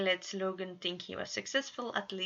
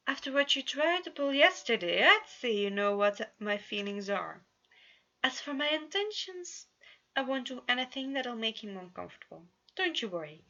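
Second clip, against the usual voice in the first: higher, a touch louder prince voice; Roman defensive; 5 semitones higher, 4 dB louder than usual. A narrator's reading. Roman answers Janus, lightly defensive and higher. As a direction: bristle then reassure.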